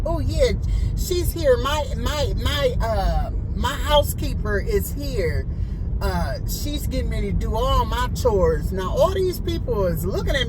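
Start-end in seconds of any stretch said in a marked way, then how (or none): 0.1-8.7 hammed-up high snooty client; mock bossy client. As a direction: put on a high proud voice showing off to guests. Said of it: "She was like".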